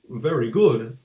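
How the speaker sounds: ordinary delivery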